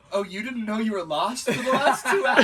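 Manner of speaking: to the friend who never knew he was lost, laughing; laughing disbelief. Retold or imitated. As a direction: tease him, barely holding the laugh.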